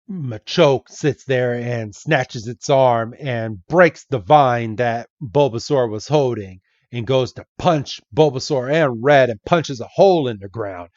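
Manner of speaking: plain reading voice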